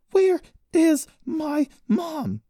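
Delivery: high, slow, word-by-word yell; furious yell. A narrator's reading. Daughter punching her mother's suspected kidnapper, furious yelling. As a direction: yell each word like a blow. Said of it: "she yelled as she landed blow".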